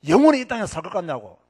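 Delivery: loud opening burst of rebuke; retold rebuke shout. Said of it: "내가 그렇게 고함을 질러도요". (크게 터뜨리는 호통 재연)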